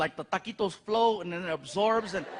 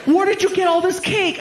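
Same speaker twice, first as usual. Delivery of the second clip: put-on much higher, louder demanding girlfriend; 9 semitones higher, 9 dB louder than usual. Girlfriend at night, much higher and louder, demanding. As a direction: press him like a suspicious girlfriend. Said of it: "girlfriend demanding".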